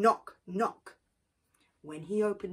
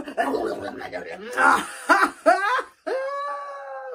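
high loud gloating cackle; greedy laugh; 9 dB louder than usual. Acted. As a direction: cackle over the eaten yams. Anansi gloats after eating.

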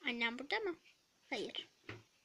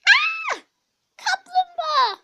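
scream; 15 dB louder than usual. Acted. Startled by a turtle.

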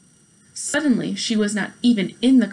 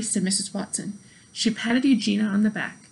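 ordinary delivery, nothing marked

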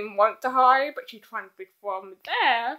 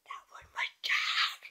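whisper, softer, to the camera; whispered aside; 7 dB quieter than usual. Real, not acted. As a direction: confide in a hushed whisper.

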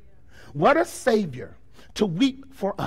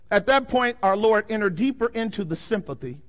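ordinary talk

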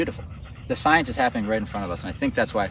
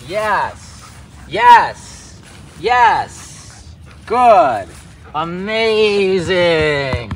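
higher, louder praise, amazing stretched very slow; excited praise; 5 semitones higher, 5 dB louder, much slower than usual. Real, not acted. Trainer praising dog's good recall, excited and drawn out. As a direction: draw out each word of praise. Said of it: "See, I'm acting a little different now".

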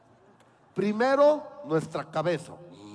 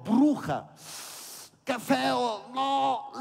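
mock disgust; 6 semitones higher, much slower than usual. Boy disgusted at mirror.

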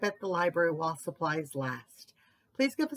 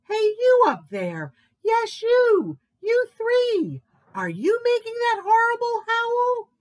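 high, loud shout up a tree; big high-pitched demand; bellowing moose; 14 semitones higher, 7 dB louder than usual. A narrator's reading. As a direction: call up to them, loud and insistent. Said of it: "bellowed Moose".

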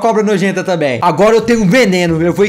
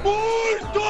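squeaky shout; 13 semitones higher, 9 dB quieter than usual. Real, not acted. Tisha responde gritando.

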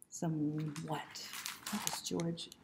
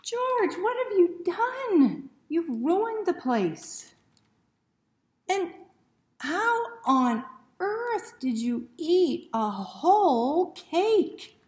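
much higher, loud, slow exasperated scold; Harry scolding; 12 semitones higher, 11 dB louder, much slower than usual. A narrator's reading. Harry scolding George over the ruined house, loud and exasperated. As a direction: scold him in shocked disbelief.

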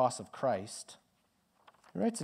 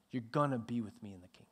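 quick whispered promise; 3 semitones lower, 6 dB quieter, faster than usual. Real, not acted. Preacher voicing Jesus' promise to disciples, quick whisper. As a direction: whisper it as a secret.

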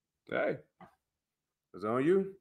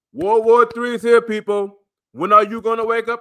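shouting warning; 7 semitones higher, 6 dB louder than usual. Real, not acted.

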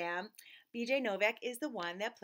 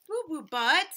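incredulous repeat; 5 semitones higher, 8 dB louder than usual. Acted.

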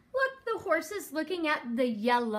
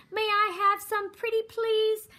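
higher-pitched, sweet-talking plea; pleading; 7 semitones higher than usual. A narrator's reading. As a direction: beg politely for a treat. Mouse begging the sheep for cheese.

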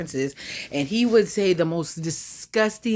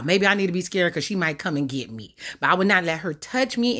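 ordinary talk

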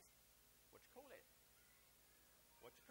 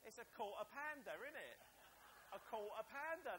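"quarter pounder" in broad Essex, louder; Essex accent; 5 dB louder than usual. Shown as a demonstration. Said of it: "That's how you've got to say it".

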